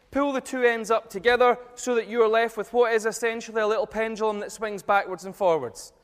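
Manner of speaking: alzati e proiettata, guida la sala; loud presenter voice. Real, not acted. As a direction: ordini chiari a tutta voce alla platea. Runs a group experiment.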